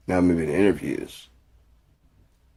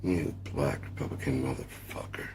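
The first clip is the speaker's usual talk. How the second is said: quiet repeat; 3 semitones lower, 9 dB quieter than usual. Pretend.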